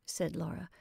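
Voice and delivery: plain reading voice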